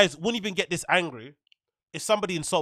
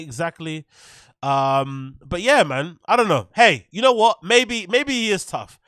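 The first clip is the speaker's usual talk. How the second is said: ordinary delivery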